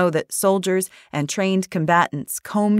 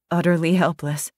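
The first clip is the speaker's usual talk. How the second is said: usual speaking voice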